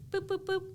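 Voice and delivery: high, playful beeping; robot beeps. Retold or imitated. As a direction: chirp it like a little machine. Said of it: "This is just my sound effect for everything".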